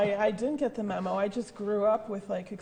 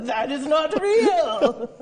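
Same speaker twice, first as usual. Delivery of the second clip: mock outraged shout; 7 semitones higher, 10 dB louder, slower than usual. Acted outraged reader quote.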